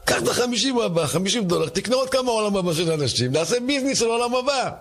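shouting wife. Acted. Rich wife shrieking at her husband over fifty dollars. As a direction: screech at him like a furious rich wife.

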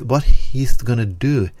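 usual speaking voice

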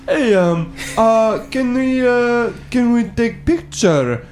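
very slow, high, badly faked Eastern European accent; bad Eastern European-sounding accent. Stranger hesitantly asking for a picture, bad Eastern European accent. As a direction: drag it out in a clumsy mock Eastern European accent.

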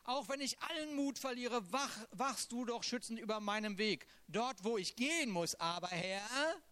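impassioned reading of a lament; psalm reading. Acted. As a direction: pour the psalm out like a plea. Reads Psalm 142 aloud.

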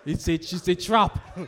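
loud laughing warning. Real, not acted. Preacher laughs as he warns young couples it's a trap.

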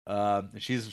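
ordinary delivery

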